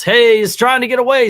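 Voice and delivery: high, loud alarmed yell. Retold or imitated. Steve calling out as the thief escapes, a loud yell. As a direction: shout it after the fleeing villain. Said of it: "and he yells".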